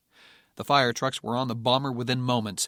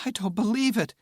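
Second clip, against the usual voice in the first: stunned disbelief; 10 semitones higher than usual. Acted. Stunned relief.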